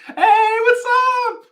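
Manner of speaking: high, loud, drawn-out hello; delighted shout of welcome; excited greeting. Real, not acted. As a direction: holler happily to a friend who just turned up. Greeting a viewer who joins.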